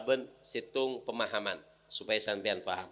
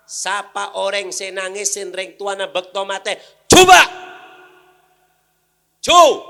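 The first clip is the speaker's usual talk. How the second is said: high, loud preaching call; full-force preaching challenge; 8 semitones higher, 18 dB louder than usual. Acted. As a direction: throw the challenge at the crowd. Preacher challenges crowd loudly.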